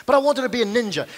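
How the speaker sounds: energetic, insistent claim; emphatic restatement. Acted. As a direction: declare it with renewed gusto. Restates the confession with renewed energy.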